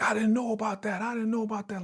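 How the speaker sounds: high-pitched, protesting repetition; whiny mock protest; acted defensive denial. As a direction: imitate someone insisting on his innocence. Acts out accused man's denial.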